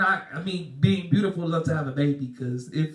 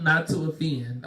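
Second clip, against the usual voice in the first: normal talking voice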